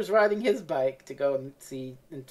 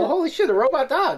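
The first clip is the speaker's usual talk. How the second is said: surprised exclamation; 10 semitones higher, 4 dB louder, faster than usual. Retold or imitated. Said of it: "He's like".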